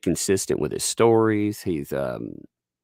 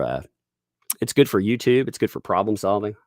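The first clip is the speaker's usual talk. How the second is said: usual speaking voice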